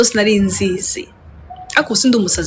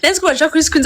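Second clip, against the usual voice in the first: ordinary talk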